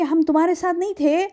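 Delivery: ordinary talk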